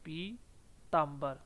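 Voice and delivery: पीताम्बर drawn out very slowly, the long पी held; पीताम्बर spelt out by sound, slowly; slow model word. Shown as a demonstration. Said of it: "बड़ी ई की मात्रा आती है". (पीताम्बर, बहुत धीरे)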